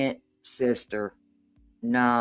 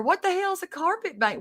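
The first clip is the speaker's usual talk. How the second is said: incredulous. Real, not acted. Reacting to clip in disbelief.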